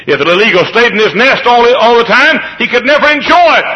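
loud, high pulpit shout; impassioned preaching. Real, not acted. Preacher teaches about the eagle's fall, impassioned shout. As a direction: proclaim it at full force.

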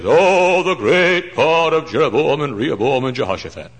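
high, loud, sing-song mock prayer; chanting the names like a showy pulpit prayer; pompous prayer voice. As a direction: lampoon a stiff formal preacher at prayer. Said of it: "I don't have to pray like that".

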